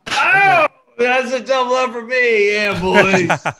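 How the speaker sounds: high, loud whoop easing down; triumphant cheer. Real, not acted. Player crowing to the group over a double up, triumphant. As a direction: shout it out in celebration. Cheering own winning guess.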